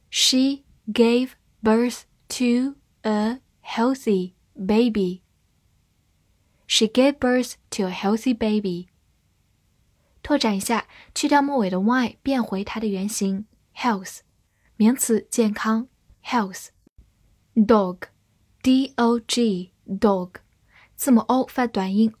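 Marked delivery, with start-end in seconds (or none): 0.1-5.2 slow, word by word, each word clear; slow reading. Shown as a demonstration. Teacher reads the sentence slowly to students. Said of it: "好，慢慢来读。". 17.5-20.3 slow spelling. Shown as a demonstration. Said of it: "spelling demo, very slow".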